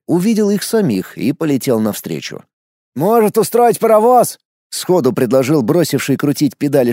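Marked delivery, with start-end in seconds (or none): 3.0-4.4 excited suggestion. A narrator's reading. Character proposes eagerly.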